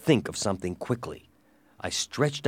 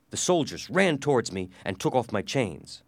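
plain reading voice

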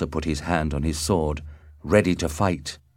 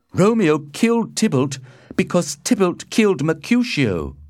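high, louder, insistent report; earnest account; 11 semitones higher, 5 dB louder than usual. A narrator's reading. Benvolio tells the Prince who killed whom, high and insistent. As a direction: explain it urgently, pitched up.

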